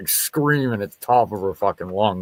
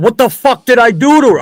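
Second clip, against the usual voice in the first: outraged yell; 13 semitones higher, 9 dB louder than usual. Retold or imitated. Arrested, protesting to the cop.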